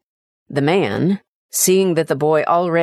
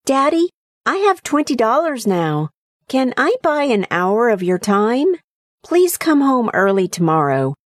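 put-on higher, earnest childlike plea; young son's voice; 7 semitones higher than usual. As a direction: ask sincerely like a small boy. Boy's closing plea.

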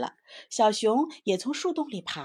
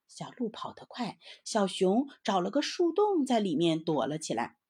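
ordinary delivery

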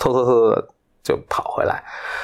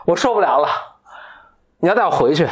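mock wail, pitched higher but no real sobs; retold wailing; 11 semitones higher than usual. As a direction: play at bursting into tears. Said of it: "突然大哭起来了".

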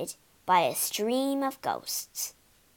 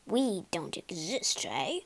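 softer, lower, stress on "We"; quiet wry thought; 5 semitones lower, 5 dB quieter than usual. Acted. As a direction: ghost muses to himself, softly and wry. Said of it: "thought Mister Quiver".

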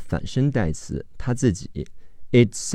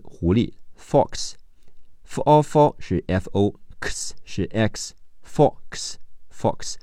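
usual speaking voice

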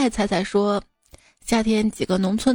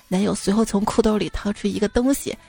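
ordinary talk

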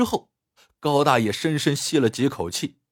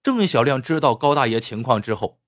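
usual speaking voice

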